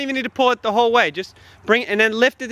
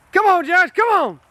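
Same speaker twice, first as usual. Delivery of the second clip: urging shout; 7 semitones higher than usual. Real, not acted. Urging Josh to pull harder.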